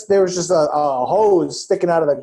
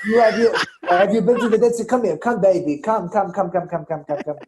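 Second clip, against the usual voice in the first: aggressive Israeli kiosk guy; 3 semitones higher than usual. Retold or imitated. Guy imitating mall kiosk seller luring shoppers, Israeli accent. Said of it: "a really aggressive Israeli guy who goes".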